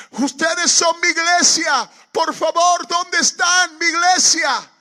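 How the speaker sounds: high, drawn-out pleading shout; desperate calling. Retold or imitated. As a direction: cry out for a missing congregation at full volume. Retold desperate calling in the field.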